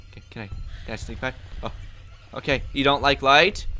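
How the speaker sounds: normal talking voice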